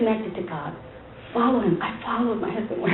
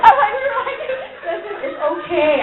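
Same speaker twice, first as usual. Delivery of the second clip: laughing; 9 semitones higher than usual. Real, not acted. Wife laughing as she retells the nervous drive. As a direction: break into real laughter while telling it.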